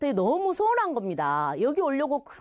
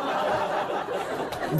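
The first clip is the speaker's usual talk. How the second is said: breathy laugh. Real, not acted. Laughs at the anecdote.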